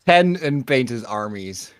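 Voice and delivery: dramatic announcement. Real, not acted. Dramatic confession.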